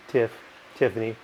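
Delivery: normal talking voice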